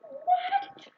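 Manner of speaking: high, loud outraged yelp; wounded squeal of disbelief; shocked cry. Retold or imitated. Bitten again, outraged shock.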